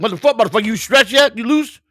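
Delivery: high, loud imitated holler; manager yelling. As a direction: holler at the player like an impatient manager. Manager yells down to player.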